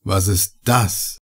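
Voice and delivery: low, slow, hushed and fearful; trembling voice. Acted. Heiner asks his companions about the horror, quietly afraid. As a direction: ask it half-frozen with dread. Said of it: "Seine Stimme zitterte".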